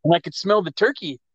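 ordinary delivery

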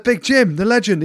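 high, loud announcer-style build-up; mock proclamation. Telling the doctor the big name, proudly and loudly. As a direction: introduce him like a champion.

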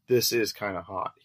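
ordinary delivery

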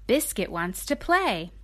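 ordinary delivery, nothing marked

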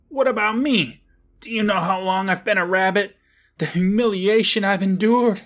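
high, hammed-up wounded outburst; mock self-pitying rabbit complaint; melodramatic indignation.

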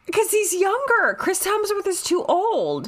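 high squealed explanation; excited justification. Real, not acted. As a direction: argue it way up high, still giddy. Excitedly justifies the shared pick.

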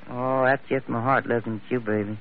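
low, soft, smitten crooning; lovestruck sweet talk. Acted. Smitten cowboy sweet-talks the pretty girl, soft and low. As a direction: melt over her like a lovesick cowboy.

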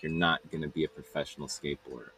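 low, very soft imitated dismissal; dismissive put-down. Stepsister writes off his skateboarding dream, dismissive. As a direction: drop down low and write him off.

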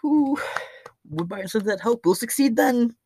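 very high-pitched mock voice; squeaky-high imitation of naive waiting; mocking hopeful Texans. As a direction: parody people waiting hopefully.

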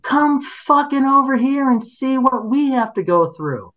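retold angry demand. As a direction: imitate the neighbour's furious order. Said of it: "the other thing they said is".

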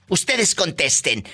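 ordinary talk, nothing marked

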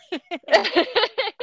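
high delighted laugh; happy laugh. Real, not acted. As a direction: laugh out with joy. Laughing after asking gender.

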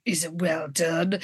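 low, soft, slow question; moody Devon. Retold or imitated. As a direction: imitate a sullen guy, barely bothering.

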